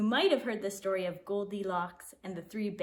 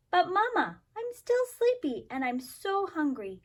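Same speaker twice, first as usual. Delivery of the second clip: a lightly voiced whiny little girl, higher; whiny child; 7 semitones higher than usual. Goldie complains to her mother, lightly whiny and higher. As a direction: plead like a sleepy hungry kid. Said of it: "Goldie's protest to mother".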